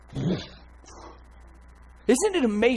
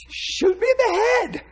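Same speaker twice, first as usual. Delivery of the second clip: much higher, louder and slower on "Shoot me in the head"; emphatic shout; 6 dB louder than usual. Shown as a demonstration. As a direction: yell "Shoot me in the head" high and drawn out, like a plea. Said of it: "come on, if you're gonna shoot me".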